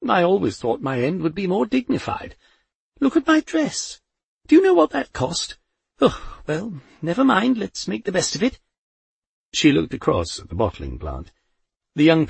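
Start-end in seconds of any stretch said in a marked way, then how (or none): 0.1-8.6 put-on high indignant old lady, rising over her ruined dress; old woman fussing to Death about her dress, indignant; Poldy, indignant. A narrator's reading.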